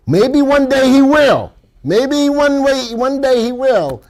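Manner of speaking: high, slow wishful lament; high wistful sigh. Real, not acted. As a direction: sing it up high and slow like a wish. Wishful aside about son.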